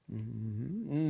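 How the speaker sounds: normal talking voice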